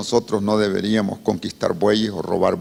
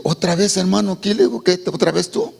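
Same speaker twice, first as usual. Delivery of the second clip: higher, incredulous "otra vez tú"; shocked disbelief; 7 semitones higher than usual. Acted. Offended brother sees the returning visitor, incredulous. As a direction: react like you cannot believe he's back.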